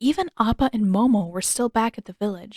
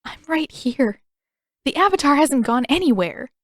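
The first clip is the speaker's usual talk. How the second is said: bitter; 6 semitones higher, 4 dB louder than usual. A narrator's reading. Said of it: "bitter part of her wanted to laugh".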